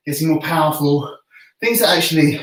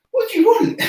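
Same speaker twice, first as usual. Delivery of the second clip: very high squeak at the dog; squeaky pet voice. Real, not acted. Man squeaking a silly question at his dog.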